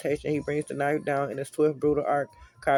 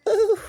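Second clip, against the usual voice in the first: excited shout; 8 dB louder than usual. Real, not acted. Listener reacts to the threat.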